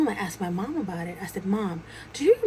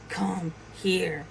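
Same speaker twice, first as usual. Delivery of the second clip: menacing; 4 dB quieter than usual. Retold or imitated. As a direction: beckon with quiet, deliberate threat. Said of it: "the voice started getting more menacing".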